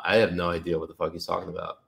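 ordinary delivery

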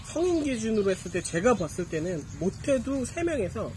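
ordinary delivery

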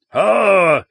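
loud defiant roar before a charge. A narrator's reading. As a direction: bellow it in their faces. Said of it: "he roared".

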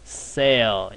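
sale spoken slowly, vowel stretched for the class; slow demo. Shown as a demonstration. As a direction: say sale slowly and clearly for students. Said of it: "像sale". (放慢示范念sale)

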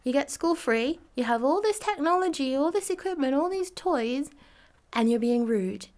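put-on high, loud telling-off of ungrateful kids; a hint of an exasperated lecture; indignant scolding. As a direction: scold them for their rudeness. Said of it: "To kids being like".

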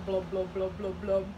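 low, soft bubble noises; quiet, low underwater burbles; fish blubbing. Acted. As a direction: blub like a fish. Voices the fish.

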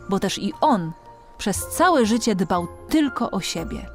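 usual speaking voice

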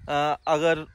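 normal talking voice

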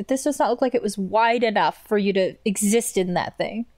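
ordinary talk, nothing marked

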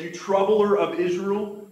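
accusing king. Acted. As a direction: hurl the accusation slowly, as a quoted king. Voices Ahab accusing Elijah.

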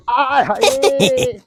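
slow, high laughing sigh; gloating laugh. Acted. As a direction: savour it with a laugh. (ਹੱਸ ਕੇ ਆਏ ਹਾਏ)

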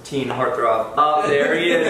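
ordinary delivery

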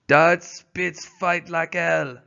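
put-on French-Canadian dog driver praising Spitz's fighting, slow accented voice; Perrault's French-Canadian accent. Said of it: "said Perrault".

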